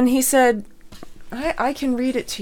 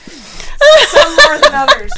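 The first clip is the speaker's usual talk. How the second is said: laughing; 13 dB louder, slower than usual. Real, not acted. Laughing while reading family text.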